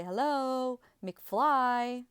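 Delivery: retold dad knocking on a kid's head, mock taunt; mocking angry father. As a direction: sneer it like a scolding father. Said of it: "knock on our head and say".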